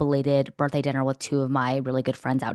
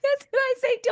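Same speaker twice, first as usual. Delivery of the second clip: excited squeal. Real, not acted. Excited agreement about eating dog.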